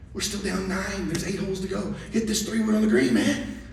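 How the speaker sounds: high, strained, pressing orders; urgent coaching. Retold or imitated. Dad to son with eight holes left, strained and urgent. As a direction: push him to go for it, pleading.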